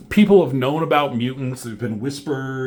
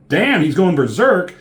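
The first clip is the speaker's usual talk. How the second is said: alarmed exclaim; 6 semitones higher, 6 dB louder than usual. Retold or imitated. Character exclaims at rampage.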